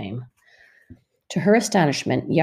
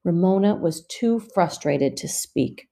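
ordinary delivery

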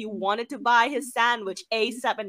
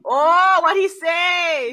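excited; 8 semitones higher, 11 dB louder, much slower than usual. Real, not acted. Eager to hear the comeback.

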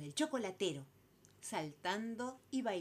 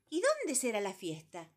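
louder, higher chirpy bird voice; noisy bird chatter; 6 semitones higher, 6 dB louder than usual. Acted. Calandria noisily asking number three about the party. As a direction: make a racket of the question like a busybody bird. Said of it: "siguió bochincheando la calandria".

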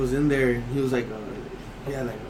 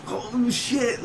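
retold dismay; 9 semitones higher than usual. Retold boss reacting to news.

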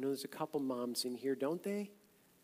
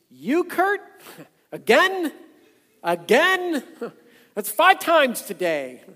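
much higher and louder mock exasperation with a laugh; exasperated God; 11 semitones higher, 19 dB louder than usual. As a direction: play an annoyed God fed up with Kurt. Imagined weary God answering Kurt.

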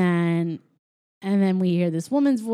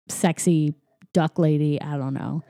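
ordinary talk, nothing marked

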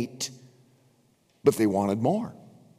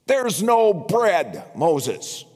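complaining Israelites; 3 semitones higher than usual. Retold or imitated. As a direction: whine like a crowd sick of the desert. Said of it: "and they said".

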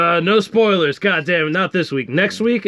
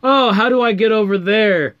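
higher-pitched mock question; mock helpless player; 6 semitones higher than usual. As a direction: whine it like a clueless player.

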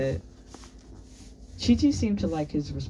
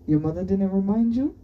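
lightly amused, lifted higher and louder; amused, with laugh; 6 semitones higher, 5 dB louder than usual. A narrator's reading. Anatol teases Orlu about the surprise, amused. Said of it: "Anatol said with a laugh".

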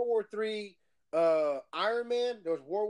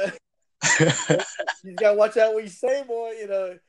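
louder, laughing through a friendly warning; 7 dB louder than usual. Real, not acted. Laughing while warning his friend to watch what he says. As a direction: chuckle out a playful caution.

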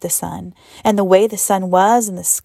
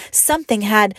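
usual speaking voice

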